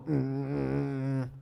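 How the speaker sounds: lowest possible closed-mouth hum; low techno bass hum. Shown as a demonstration. Teacher demos the techno bass to kids, low hum. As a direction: hit your bottom note, mouth shut. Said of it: "you go".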